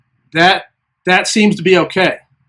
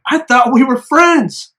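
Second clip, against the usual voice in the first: high, slow incredulous plea; drawn-out mock-wounded appeal; hurt protest; 7 semitones higher, slower than usual. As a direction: re-enact feeling betrayed, stretched out and pitched up. Protests to friends turned on him.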